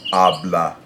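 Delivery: "habla" with the weight on the first syllable; stress on first syllable. Shown as a demonstration. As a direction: stress the first A in "habla", keep the final one light. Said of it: "natural stress is on that first syllable".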